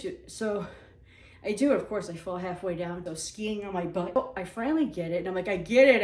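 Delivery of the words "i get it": mock triumph. Excited realization, acted.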